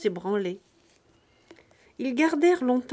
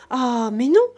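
a much louder "Ah, mais non", rising on "non"; second "Ah, mais non" much louder, nearly shouted; louder furious refusal; 3 semitones higher, 10 dB louder than usual. A narrator's reading. Farm wife repeating her refusal, even angrier. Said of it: "toute furieuse".

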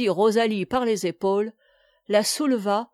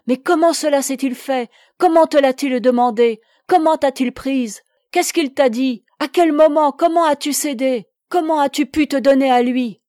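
urgent, louder, higher volley of questions; hurried questioning; 4 semitones higher, 7 dB louder than usual. A narrator's reading. Jeanne pressing her maid with questions, hurried and urgent. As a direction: fire the questions off as if choked by them. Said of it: "interrogea d'une voix précipitée".

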